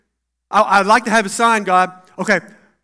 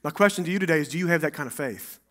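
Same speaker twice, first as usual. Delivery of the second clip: ordinary talk, nothing marked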